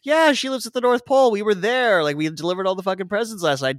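put-on a child excitedly telling mom everything; excited kid. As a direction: spill it all at once like a thrilled kid. Kid blurts Santa story to mom.

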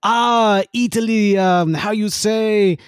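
put-on playful stab at an Italian accent. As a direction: fumble towards a mock Italian accent. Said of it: "do an Italian accent".

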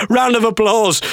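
high, mock announcer call; imitated promoter. Promoter hails the comedian, high mock announcement. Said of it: "says the promoter".

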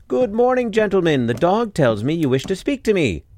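high, loud booming welcome; hearty greeting. A narrator's reading. Shoemaker greeting the robbers who came to punish him, high and loud.

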